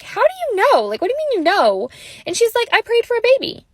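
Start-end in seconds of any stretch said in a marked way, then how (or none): 0.0-1.9 much higher, startled disbelief; shocked. Retold or imitated. Mom stunned that daughter already knew. 2.7-3.7 put-on matter of fact child. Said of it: "so matter of fact".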